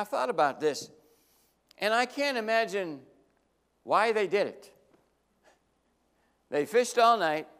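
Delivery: ordinary talk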